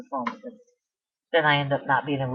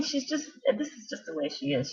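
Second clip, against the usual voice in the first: ordinary talk, nothing marked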